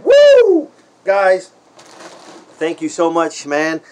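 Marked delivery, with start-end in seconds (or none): 0.0-0.7 high, loud whoop of joy; a gleeful yell of delight; excited woo. Real, not acted. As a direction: let out a big happy woo. Excited cheer.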